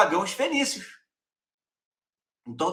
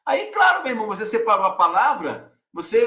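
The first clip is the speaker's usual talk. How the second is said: usual speaking voice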